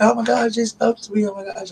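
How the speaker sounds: high squealing fangirl gasp, fading to a breathless hush; starstruck squeal. Retold or imitated. Fan squealing after a YouTuber greeted him, high and breathless. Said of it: "And I was like".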